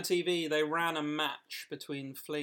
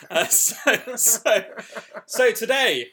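laughing; 7 semitones higher, 11 dB louder, much slower than usual. Real, not acted. Host laughing while starting.

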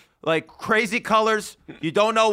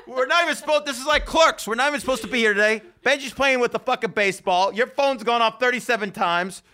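excited; 6 semitones higher than usual. Real, not acted. Laughing exasperated rant.

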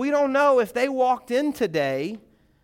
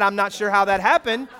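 ordinary talk

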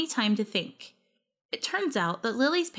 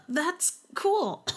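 choked words between coughs; coughing; 9 semitones higher than usual. A narrator's reading. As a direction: force it out while coughing. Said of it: "I said between coughs".

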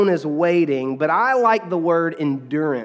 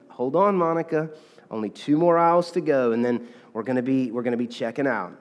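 soft, lower and calm, fading quieter; mother calming crying girl, softly; calmly; 5 semitones lower, 4 dB quieter than usual. Retold or imitated. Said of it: "the mother just calmly said".

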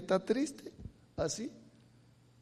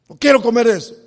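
loud, ringing sermon delivery; fiery preaching; 6 semitones higher, 17 dB louder than usual. Acted. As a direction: preach it out to the congregation. Preacher at full force.

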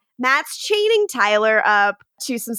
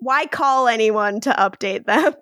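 usual speaking voice

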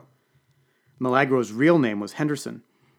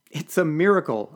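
exultant; 6 semitones higher, 5 dB louder than usual. A narrator's reading. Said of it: "I exulted".

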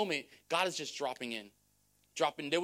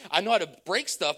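laughing; 8 dB louder than usual. Real, not acted. Laughing at own clumsiness.

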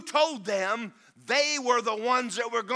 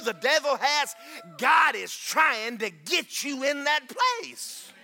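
slow, louder preaching shout; preaching at full force; 5 semitones higher, 4 dB louder, much slower than usual. Acted. Pastor rallying his church toward the promised land, slow shouted preaching. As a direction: drive each phrase home to the congregation.

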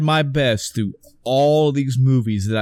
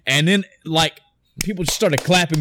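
ordinary talk, nothing marked

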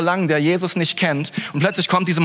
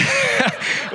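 laugh. Real, not acted. Joking about Kuh-Christen.

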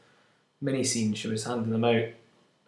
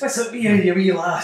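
put-on high, loud Scottish accent; 8 semitones higher, 8 dB louder than usual. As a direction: ham up a Scottish brogue. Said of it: "he says that Scottish".

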